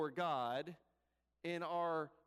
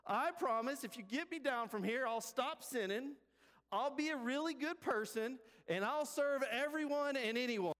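high-pitched, pleading promises; acted plea, pitched high throughout; desperate bargainer; 7 semitones higher than usual. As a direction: beg and bargain with God from a cliff. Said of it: "Jack in his reply says".

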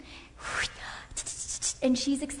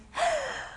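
high sudden gasp of excitement; sharp excited gasp. Shown as a demonstration. Demo to friend, a high excited gasp. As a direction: gasp like you've got big news. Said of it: "you come up and you're like".

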